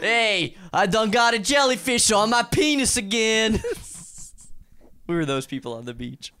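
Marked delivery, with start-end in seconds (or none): 0.0-3.6 hillbilly voice. Pretend. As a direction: whine it like a backwoods boy. Hillbilly joke continues.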